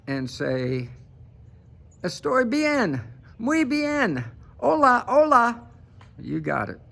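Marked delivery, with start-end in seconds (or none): 2.0-5.6 much higher, louder mock Spanish voice. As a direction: squeal the Spanish like a hammed-up caricature.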